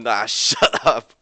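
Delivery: loud, laughing dismissal. Acted. Ryan brushing off teasing friends, laughing.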